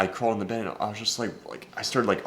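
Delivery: ordinary talk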